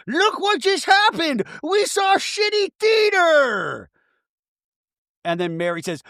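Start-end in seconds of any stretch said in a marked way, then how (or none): 0.1-3.9 very high, slow crying yell; hammed-up sobbing outrage; wailing Meredith impression. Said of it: "And then Meredith going".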